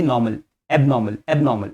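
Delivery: short "eb-naw-mul" for एबनॉर्मल; said quickly. Shown as a demonstration. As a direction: join "eb" straight onto नॉर्मल. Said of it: "जल्दी से बोलिए".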